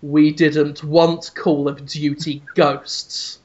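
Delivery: low, slow, deliberate pronouncement; Reggie. Acted. Host voices an executive answering the captive, low slow menace. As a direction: say it ominously, unhurried and low. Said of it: "just looks at him and says".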